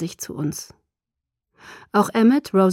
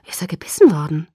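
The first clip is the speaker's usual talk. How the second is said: a touch of horror in the question; aghast question with a sudden jump in pitch; horrified; 3 semitones lower, 4 dB louder, faster than usual. A narrator's reading. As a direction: ask it in shocked alarm. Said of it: "fragte ich entsetzt".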